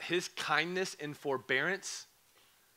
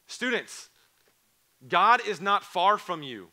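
full-force preaching; 5 semitones higher, 6 dB louder than usual. Acted. Pastor preaching to students, loud and rising. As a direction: preach it out to the room.

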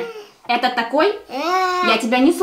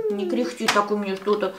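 ordinary talk

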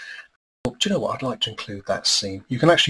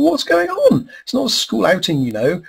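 put-on much higher, louder, scolding girl; indignant Lucy; 11 semitones higher, 6 dB louder than usual. Lucy scolding Pete over the mess, high indignant voice. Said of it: "And Lucy's going".

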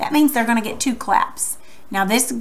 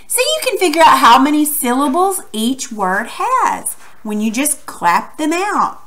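ordinary delivery

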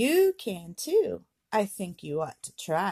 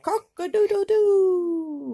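high, loud, slow cock-a-doodle-doo; hammed-up rooster call; rooster crow; 10 semitones higher, 9 dB louder, slower than usual. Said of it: "He can sound like a rooster".

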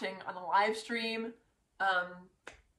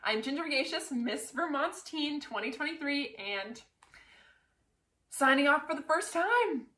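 sign-off; 4 semitones higher than usual. Real, not acted. Vlog sign-off as titleholder.

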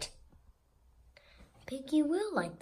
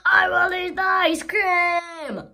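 slow, higher, much louder wailing cry; a big dramatic crying yell; anguished shout; 7 semitones higher, 12 dB louder than usual. Acted. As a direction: wail it out in greedy anguish. Character decides loudly, anguished.

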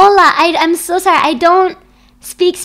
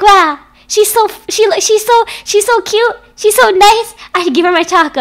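put-on higher, louder gushing child voice; adoring kids; 7 semitones higher, 5 dB louder than usual. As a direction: gush like a kid handing over candy. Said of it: "They look at me, they're like".

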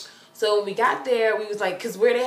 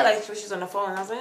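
normal talking voice

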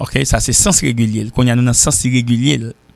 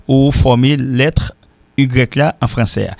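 ordinary talk

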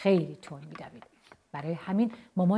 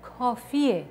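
raised, loud storybook cry of "enough"; exasperated yell; 5 semitones higher, 5 dB louder, much slower than usual. A narrator's reading. Mum stops dad's vacuuming, exasperated shout. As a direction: call it out, fed up. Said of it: "مامان داد زد".

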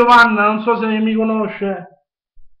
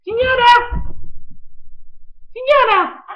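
put-on squeaky high kid voice calling out; child voice; 13 semitones higher, slower than usual. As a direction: play the little boy, calling out high. Prank caller voices the son.

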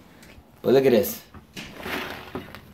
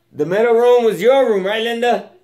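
raised voice calling across the house; 10 semitones higher, 5 dB louder than usual. Real, not acted. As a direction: call out to someone in another room. Calling to Linda in another room.

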